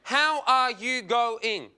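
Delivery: "How are you going" slow, word by word; full-length greeting. Shown as a demonstration. Comic setting up a shorter greeting, "How are you going" spelled out. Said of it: "There's more".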